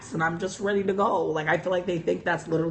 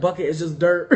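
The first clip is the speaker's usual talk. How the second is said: ordinary talk, nothing marked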